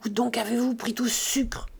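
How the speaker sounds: whispered interrogation; menacing whisper. A narrator's reading. Grandet questions his frightened wife about sugar, whispering. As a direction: whisper sharply into an ear. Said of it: "Il se pencha vers l'oreille".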